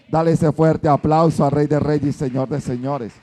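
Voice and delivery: loud, low rallying shout to the crowd; rousing call. Acted. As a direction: rouse the church to clap. Calls congregation to applaud. (llamado fuerte a aplaudir)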